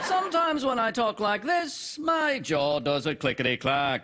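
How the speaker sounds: high start sliding steeply down; mock presenter sing-song, dropping from high pitch; British TV presenter. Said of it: "talk like someone on television in Britain".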